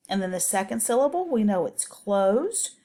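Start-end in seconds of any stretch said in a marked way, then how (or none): none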